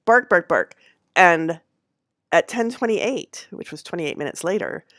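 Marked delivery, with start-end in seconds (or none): 0.0-0.8 imitated barking. Imitating the dog barking again.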